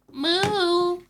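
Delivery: high, loud, drawn-out moo; long high cartoon cow moo. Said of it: "I found my new accent".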